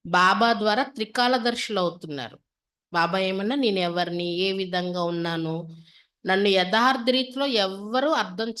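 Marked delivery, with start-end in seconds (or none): none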